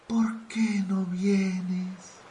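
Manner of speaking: very slow, drawn-out pleading; plaintive. Acted. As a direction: stretch it out like a forlorn lover. Waiting groom calls missing bride. (lamento lento y suplicante)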